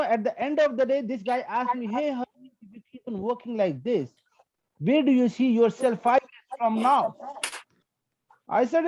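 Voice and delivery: usual speaking voice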